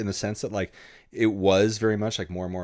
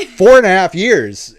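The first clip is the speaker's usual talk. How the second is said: higher, much louder, slow incredulous echo; stunned disbelief; 11 semitones higher, 17 dB louder, slower than usual. Retold or imitated. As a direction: blurt it back in total disbelief. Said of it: "And, and I was like".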